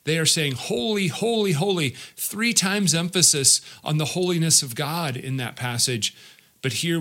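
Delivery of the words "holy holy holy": high, lifted holy; seraphim's praise. Retold or imitated. Seraphim crying out praise to God, lifted and high. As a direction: lift each holy like angels singing praise. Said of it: "what the seraphim are saying".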